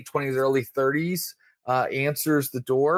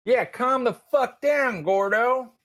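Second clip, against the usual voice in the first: mocking jeer; 11 semitones higher, slower than usual. [name] mocking back.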